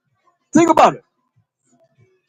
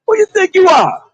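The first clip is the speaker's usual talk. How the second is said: indignant challenge; 11 semitones higher than usual. Real, not acted. Rebuking arrogant prayer.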